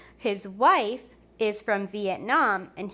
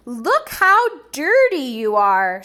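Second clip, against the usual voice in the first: mild mother's scolding, a touch louder; 2 semitones higher, 9 dB louder than usual. Acted. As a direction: scold the muddy bunny. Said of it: "scolded his mother".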